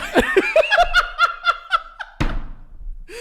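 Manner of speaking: long, very high cackling laugh; real laughing fit; laughing hard. Real, not acted. Cracks up at retold line.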